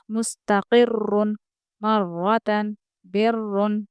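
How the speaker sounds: slow मुस्तकिर्रुन with a doubled, full ra; heavy ra recitation. Shown as a demonstration. Teacher reciting मुस्तकिर्रुन slowly with a heavy ra. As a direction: draw out मुस्तकिर्रुन, ra heavy and held. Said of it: "हम इसको पुर पढ़ेंगे".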